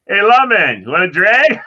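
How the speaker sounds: loud, high mocking call; taunting teenager. Teenager in the Chevelle taunting the cop, loud mocking call. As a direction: jeer at the cop from the car.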